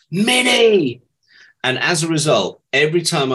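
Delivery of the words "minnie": calling out the nickname, high and loud; delighted greeting. Retold or imitated. An old friend recognises her at a party, delighted call. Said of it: "he just turned around to me and went".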